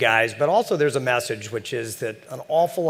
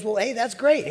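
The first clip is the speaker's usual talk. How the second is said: cheery newcomer; 11 semitones higher than usual. Retold or imitated.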